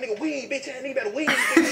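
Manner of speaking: high, loud yell through laughter; laughing mock yell; mock shouting ex. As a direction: shout it like the angry ex. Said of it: "She like".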